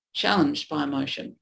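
usual speaking voice

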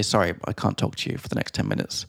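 unvoiced hushed whisper. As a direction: whisper it like a secret. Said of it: "I had to say".

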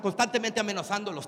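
ordinary delivery

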